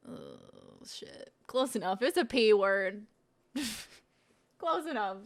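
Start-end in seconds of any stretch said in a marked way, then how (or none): 3.5-5.3 amused, laughing through the words. Real, not acted. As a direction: laugh at your own slip. Laughing at own wrong word.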